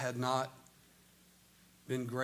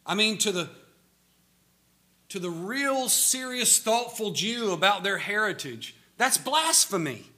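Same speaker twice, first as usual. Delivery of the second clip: louder, higher, driving sermon voice; full-force preaching; 8 semitones higher, 7 dB louder than usual. Acted. Preacher pressing the famine story on his congregation, full-force preaching. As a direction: preach it out to the whole church.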